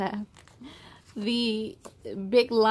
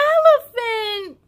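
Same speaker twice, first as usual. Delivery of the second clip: loud, higher, drawn-out yell; shouting; 11 semitones higher, 8 dB louder, slower than usual. Acted. Peyton shouting the answer to her friends, loud and thrilled. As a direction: burst out with the answer. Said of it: "shouted Peyton".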